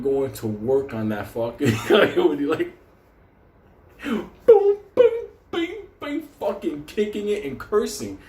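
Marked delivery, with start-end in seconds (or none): 4.0-6.3 loud high boom boom bing bing; mock hitting sounds. Imitating the beating of the coyote, loud and high. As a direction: belt out the blows like a cartoon.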